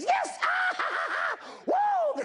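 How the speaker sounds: squealing yes and giggle; gleeful cheer. Retold or imitated. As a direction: cheer like a thrilled little runner. Girl cheering as she runs.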